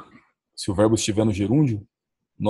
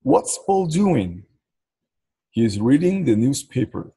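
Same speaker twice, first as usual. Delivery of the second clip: slow model sentence; 3 semitones higher, slower than usual. Shown as a demonstration. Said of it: "ler em voz alta".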